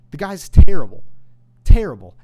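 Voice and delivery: shouted, the word terrible hammered; furious shout. Real, not acted. Host shouting down the quarterback, furious and loud. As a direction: yell it, then yell it again. Peak of angry rant.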